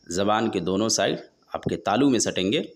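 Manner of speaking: ordinary talk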